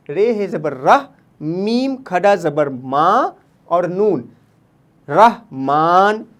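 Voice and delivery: रहमान drawn out slowly, rah-maan; model pronunciation. Shown as a demonstration. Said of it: "तो कैसे पढ़ेंगे?".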